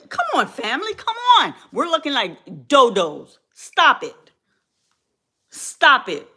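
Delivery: exasperated appeal. Real, not acted. Vlogger urging family to stop covering for him, exasperated.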